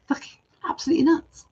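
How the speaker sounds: fast, high burst of disbelief; high, fast swear of astonishment; amazed exclamation. Real, not acted. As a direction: blurt it out, amazed. Amazed at coincidence.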